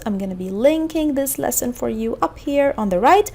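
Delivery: ordinary talk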